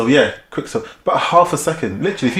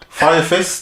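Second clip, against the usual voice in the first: put-on New Zealand accent; 5 semitones higher than usual. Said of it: "I can't do New Zealand accent".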